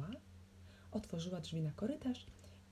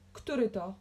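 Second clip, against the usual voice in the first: loud, gruff demand; raised, angry challenge; boomed question; 3 semitones higher, 5 dB louder than usual. A narrator's reading. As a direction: thunder it out. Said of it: "huknął".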